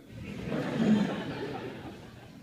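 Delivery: wheezy burst of laughter; laughing. Real, not acted. Teller recalling being caught boxing, laughing. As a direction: laugh hard at getting caught. Laughing at his own story.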